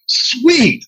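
a sudden high, thrilled yelp; excited. Retold or imitated. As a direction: squeal the word like you just got in. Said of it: "And I was like".

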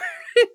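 high loud laugh bursting out; burst of laughter. Real, not acted. Beth cracks up retelling the argument with Daddy, laughing. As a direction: crack up mid-story.

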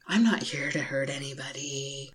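understated mimicry of the scammer. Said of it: "Except in an Australian accent".

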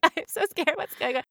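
mock-scared whimper, fading off; retold fear. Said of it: "I was like".

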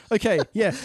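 normal talking voice

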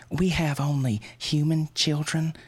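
high, thin, scared reply; small frightened voice. Acted. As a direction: say it like your courage just ran out. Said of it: "her voice is small and frightened".

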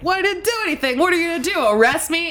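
defiant. Retold or imitated. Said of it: "he was like".

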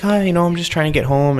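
retold innocent little-old-lady plea; sweet old lady. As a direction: lightly imitate a sweet, harmless old lady. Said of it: "just, like, sweet old lady".

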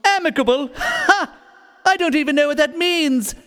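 high, mocking scoff. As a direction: scoff at the word. Villain scoffs at word.

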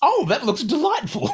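high-pitched gush with laughter in it; delighted reaction. Retold or imitated. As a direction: squeal it like a sudden convert. Retold change of heart about trailer.

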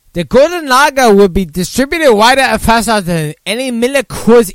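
high, loud mock Chinese accent reading news; hammed-up Chinese accent news read. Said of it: "wants me to do it in a Chinese accent".

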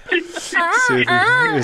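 very high, slow squeaky voice; bird call voice. Pretend. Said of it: "Bird Calls".

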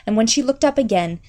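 ordinary delivery, nothing marked

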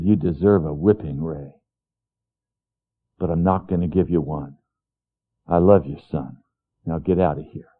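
low, hushed loving dad; tender father. Retold or imitated. Dad telling son he loves him instead of whipping, hushed. Father voiced in retold memory.

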